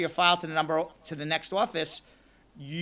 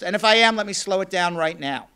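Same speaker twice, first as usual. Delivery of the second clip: ordinary delivery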